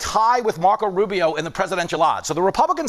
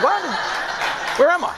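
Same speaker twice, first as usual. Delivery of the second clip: confused surprise; 7 semitones higher than usual. Retold or imitated. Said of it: "I was like".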